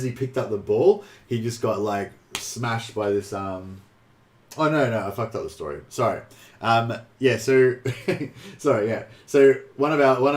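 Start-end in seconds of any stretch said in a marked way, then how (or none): none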